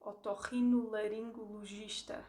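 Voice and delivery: otorrinolaringologista said in full, every syllable; full Portuguese word. Shown as a demonstration. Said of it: "if you think of this very long word".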